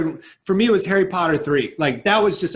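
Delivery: ordinary delivery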